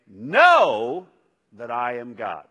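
"Know" punched loud and rising, then a pause before "that I am God"; stress on Know. Shown as a demonstration. Preacher giving a third reading of the verse with stress on "Know". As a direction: hit "Know" and let it hang. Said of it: "Or we could say".

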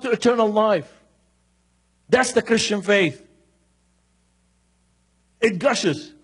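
verse swelling into full preaching; fervent preaching. Shown as a demonstration. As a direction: proclaim it like the promise it is. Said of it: "I love this verse".

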